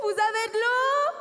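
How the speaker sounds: high, loud long-distance call; big voice. Acted. Calling across the oasis for water, big shouted call. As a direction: holler slowly to people far off. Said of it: "avec ta grosse voix".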